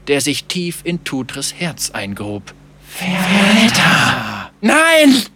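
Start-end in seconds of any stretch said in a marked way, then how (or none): 2.9-4.5 very slow, loud, drawn-out death chant; ghostly chorus. Acted. Chorus of corpses accusing the captain, ghostly chant. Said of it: "wie einen Totengesang". 4.6-5.2 high, loud scream; screamed denial. Acted. Captain denying the corpses' accusation, screamed denial. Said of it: "schrie er es leugnend".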